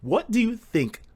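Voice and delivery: ordinary talk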